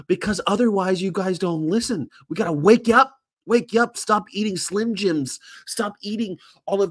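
rallying cry. Real, not acted. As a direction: shout it to the street to rouse them. Rallying passersby.

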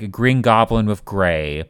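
normal talking voice

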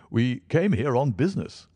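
Stamford voice. Acted.

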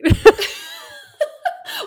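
shrieking laugh; high squealing laugh. Real, not acted. Joke about being unhinged.

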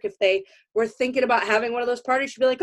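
normal talking voice

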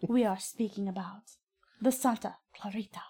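halting, dramatic mock Serbian accent; hammy Serbian accent drifting towards Spanish; Serbian accent, soap-opera style. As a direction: trail down softly in a put-on Serbian accent. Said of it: "you make it sound like it's a Spanish soap opera".

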